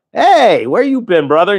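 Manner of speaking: high, drawn-out happy hail; excited greeting. Real, not acted. Greeting a friend in chat.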